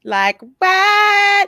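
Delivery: high, loud Southern accent on the spot; a quick Southern accent shown on request. Shown as a demonstration. Asked to show off her Southern accent, answers in Southern accent. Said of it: "we can get into a Southern accent if possible".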